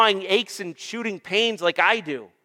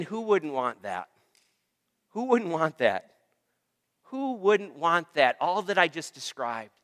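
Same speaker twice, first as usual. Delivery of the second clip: lower, softer rhetorical question; quiet wonder; 4 semitones lower, 7 dB quieter than usual. Real, not acted. Preacher asks church after listing heaven's blessings, quiet wonder. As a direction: drop the voice and let the question sink in.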